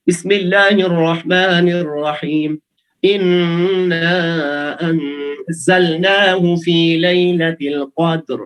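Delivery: slow, measured tajweed recitation; Quran recitation. Shown as a demonstration. Teacher reciting a verse for the class, slow and measured. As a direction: chant the verse slowly and carefully.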